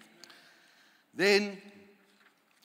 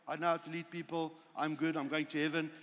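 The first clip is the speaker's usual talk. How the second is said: smug complacency; 4 semitones lower, 9 dB quieter, faster than usual. Retold or imitated. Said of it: "Is your attitude".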